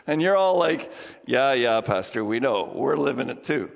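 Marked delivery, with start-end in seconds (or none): 1.3-3.7 low-pitched mock weary agreement; dismissive congregation. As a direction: imitate listeners brushing off the pastor. Voicing the weary congregation's reply.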